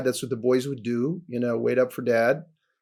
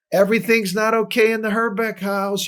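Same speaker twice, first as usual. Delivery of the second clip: a higher, put-on voice as if sounding an alarm; alarmed inner voice; 6 semitones higher than usual. Boy signalling his family is not okay, mock alarm. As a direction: voice the kid's hidden cry for help. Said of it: "or I was like".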